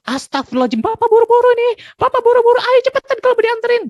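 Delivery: high, loud, fast angry shouting; hurried yelling. Retold or imitated. A rushed father shouts at the kids in the morning. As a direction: bark at the kids to hurry up. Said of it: "Pagi-pagi udah kayak gitu".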